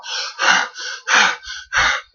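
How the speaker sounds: imitated breathless female panting; heavy panting. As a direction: pant heavily without voice, like a spooky recording. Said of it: "there was panting going like".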